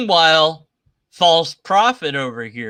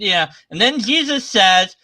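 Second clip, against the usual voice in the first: ordinary talk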